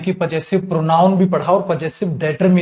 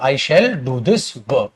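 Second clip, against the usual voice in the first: slow dictation; 4 semitones lower, 4 dB quieter, much slower than usual. Shown as a demonstration. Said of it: "मैंने एक statement लिखी".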